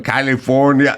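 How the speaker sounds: mock mispronounced "California", like "Kah-lee-forn-ya"; Schwarzenegger. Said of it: "How did he say it?".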